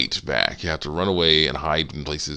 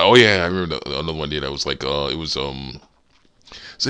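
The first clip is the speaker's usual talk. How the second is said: usual speaking voice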